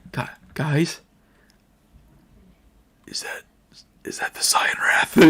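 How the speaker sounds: hushed, frightened whisper to the others; scared whisper. Acted. Raider whispering to his buddies, scared whisper. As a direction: breathe it out, terrified of being heard.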